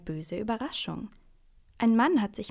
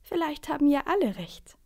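high, louder voice chiming in; mysterious newcomer; 7 semitones higher, 4 dB louder than usual. A narrator's reading. Someone suddenly interrupting the seat quarrel, lifted voice. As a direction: cut in with a lifted suggestion. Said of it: "sagt da plötzlich jemand".

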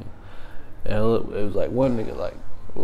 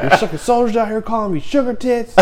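high-pitched, laughing imitation; mock whiny complaint; 11 semitones higher than usual. Civilian complaining to the sergeant, mock whine.

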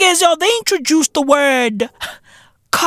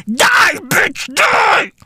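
mock yell; 5 semitones higher, much slower than usual. Mock company yelling at viewer.

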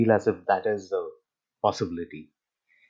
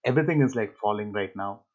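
ordinary talk

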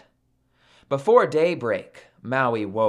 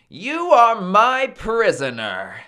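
raised, high-pitched declaration; triumphant captor; 7 semitones higher, 4 dB louder than usual. Acted. Maui claims Sun as prisoner, firm raised declaration. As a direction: announce it to your captive, voice lifted. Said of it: "said Maui".

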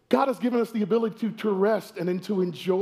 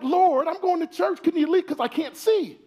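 higher, whiny mock gripe at the sun; hammed-up irritated plea, pitched up; angry complaint to God; 8 semitones higher than usual. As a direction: complain to the Lord like a spoiled kid. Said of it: "I get angry".

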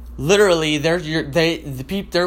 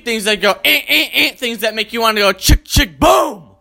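shouted, laughing mock alarm noises; alarm noise imitation; 4 semitones higher than usual. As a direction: blast out the alarm sounds, laughing. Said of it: "imitating alarm noises".

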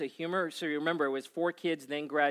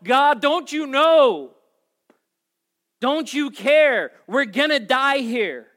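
high, loud, slow anguished plea; desperate cry; 10 semitones higher, 13 dB louder, slower than usual. Retold or imitated. Frightened believers crying out to God, anguished plea. Panicked prayer, quoted cry.

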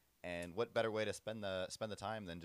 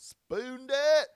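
high, loud, drawn-out kickabout yell; belted high, vowel stretched long; excited yell; 15 dB louder than usual. Retold or imitated. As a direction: yell it out as the ball flies. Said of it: "and yells".

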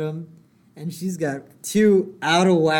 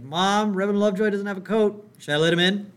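high, raised imitation of a kid calling; calling to Mom; 7 semitones higher, 4 dB louder than usual. Son at the door to Mom, calling out. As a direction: holler a question through the house.

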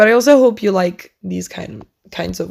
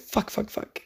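quiet panicked swearing to yourself; whispered curse; 14 dB quieter than usual. Retold or imitated. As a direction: whisper the curses under your breath. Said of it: "I was so nervous".

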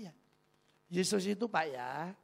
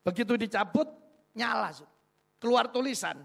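ordinary delivery

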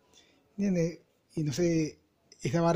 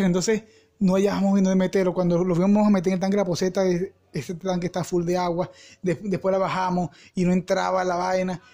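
normal talking voice

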